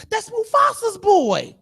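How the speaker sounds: a hint of gossiping lion, high and stretched; lightly voiced lion. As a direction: drag it out high, as one lion informing another. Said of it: "can you imagine two lions".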